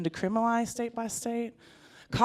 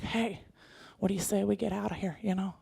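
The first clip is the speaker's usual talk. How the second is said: suggestive murmur; 7 dB quieter than usual. Retold or imitated. Husband suggestive quiet.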